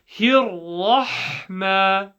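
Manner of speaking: slow, drawn-out syllables; Quranic recitation. Shown as a demonstration. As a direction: recite with long held vowels. Recites the second part.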